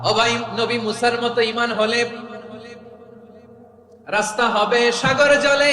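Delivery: loud, high rhymed proclamation; declaimed verse. Real, not acted. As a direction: call the verse out to the crowd.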